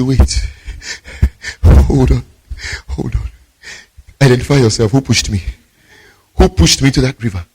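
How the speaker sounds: gasping for breath between short phrases; acted out-of-breath survivor; panting, scared. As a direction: heave hard breaths, then demand answers.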